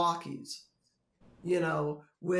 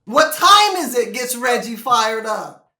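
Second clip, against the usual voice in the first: louder, higher sarcastic list; loud mocking exasperation; 4 semitones higher, 12 dB louder than usual. Real, not acted. Mocking George Karl's complaint, loud and sarcastic. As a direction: hammer each item, mocking the complaint.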